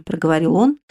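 ordinary delivery

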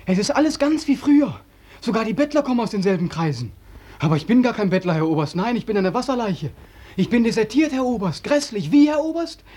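very high, shrill-edged taunting; very high, manic, taunting jeer; hysterical mockery. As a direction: needle the colonel with manic glee. Mocking the colonel hysterically.

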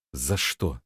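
low, hushed question; unexpectedly quiet. A narrator's reading. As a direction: ask it softly, voice dropped. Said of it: "спросил он неожиданно тихо". (неожиданно тихо, низким голосом)

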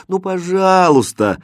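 high, drawn-out coaxing please; pleading. A narrator's reading. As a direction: stretch it out as a plea. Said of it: "умоляюще заговорила Катринка". (жалобно, умоляюще)

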